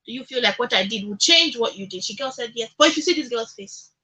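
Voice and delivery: usual speaking voice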